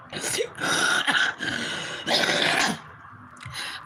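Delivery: talking through real laughter; cracking up mid-sentence; laughing. Real, not acted. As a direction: barely get it out between bursts of laughing. Helpless laughter at what they watch.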